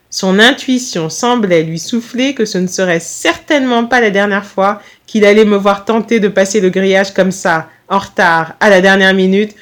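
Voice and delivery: ordinary delivery, nothing marked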